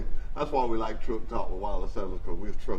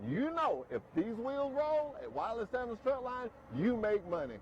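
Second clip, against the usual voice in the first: steady, pitchy live ad read for a trucking company; host reading a truck line ad on air; radio ad read; 4 semitones higher, 8 dB quieter than usual. Acted. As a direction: sell it like a local radio spot.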